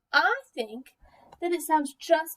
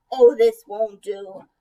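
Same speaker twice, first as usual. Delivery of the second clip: lower, louder, slow mock-dejected sigh; a droopy sigh of defeat; 4 semitones lower, 9 dB louder, slower than usual. Pugtato sighs at the frog's answer, hammed-up disappointed sigh. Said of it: "Pugtato said with a sigh".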